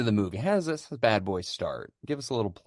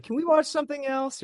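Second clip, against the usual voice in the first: put-on high child voice; 4 dB louder than usual. Daughter voiced whining.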